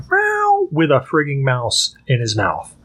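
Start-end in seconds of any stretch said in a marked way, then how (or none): 0.1-0.7 very high, drawn-out meow; cat meow. Retold or imitated. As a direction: wail it like a wandering cat. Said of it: "like an aimless puppy going".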